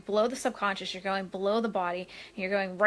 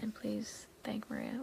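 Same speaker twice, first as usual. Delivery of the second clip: whispered aside; 11 dB quieter than usual. Real, not acted. Aside while reading chat.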